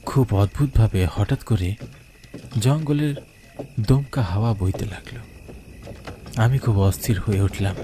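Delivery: ordinary delivery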